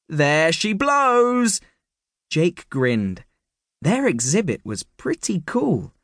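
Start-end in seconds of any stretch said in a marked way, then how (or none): 0.1-1.6 slow, higher, delighted announcement; beaming. A narrator's reading. Mister Hyde proudly presenting the giant nose. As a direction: savour it, stretched out with pride.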